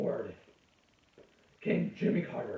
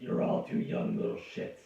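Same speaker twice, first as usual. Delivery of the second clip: ordinary talk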